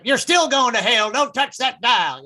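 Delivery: mock fire-and-brimstone radio sermon; radio hellfire preacher. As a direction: warn sinners like a radio evangelist. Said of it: "a lot of the stations are actually owned by the same person".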